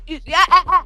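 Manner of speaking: usual speaking voice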